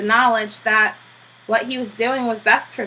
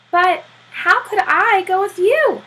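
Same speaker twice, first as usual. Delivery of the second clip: astonished Popper; 10 semitones higher, 4 dB louder than usual. Acted.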